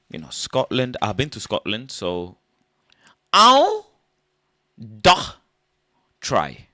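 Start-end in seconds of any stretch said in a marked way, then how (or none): 3.3-6.7 high loud mock Scottish count. Comic doing Scotland, loud high mock Scottish count. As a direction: yelp how da try in a mock Scottish lilt.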